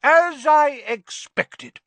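very high, loud, slow and knowing; loud, smug aunt; smug Aunt Marge. A narrator's reading. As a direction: savour being right. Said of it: "said Aunt Marge".

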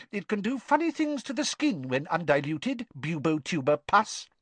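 high, brisk schoolmistress; bossy teacher, pitched high. A narrator's reading. Voices Professor Sprout.

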